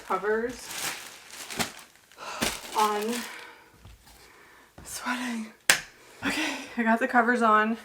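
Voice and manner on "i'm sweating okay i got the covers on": ordinary delivery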